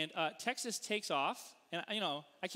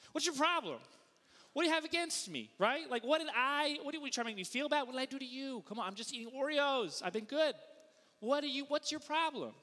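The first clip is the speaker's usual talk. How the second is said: higher, indignant comeback; defensive outburst; 6 semitones higher than usual. Acted. Husband snapping back at Amy over Oreos, defensive. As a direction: act out an affronted, hurried protest. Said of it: "How do I react".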